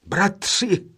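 bratři called out joyfully; joyful cry. A narrator's reading. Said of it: "vzkřikl radostně nejstarší".

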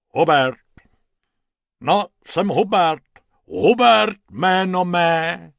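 lightly voiced hippo, loud and pompous; a big animal introducing himself grandly. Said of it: "řeklo hroším hlasem".

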